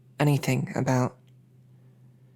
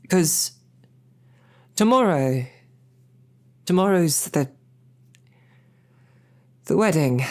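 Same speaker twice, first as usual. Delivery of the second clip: higher and a bit louder, tripping over the words; nervous excitement spilling out; flustered; 3 semitones higher, 3 dB louder than usual. Real, not acted. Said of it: "bit flustered still at the thought".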